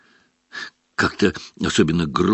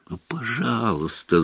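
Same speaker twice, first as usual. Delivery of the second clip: desperate whisper; 3 semitones higher, 6 dB quieter than usual. Acted. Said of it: "зашептал Гарри в отчаянии".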